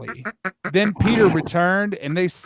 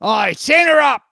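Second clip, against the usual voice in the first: higher, louder yell; shouted threat; 9 semitones higher, 5 dB louder than usual. Pretend. Lost Boy about Wendy, a loud high yell. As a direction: yell it out eagerly.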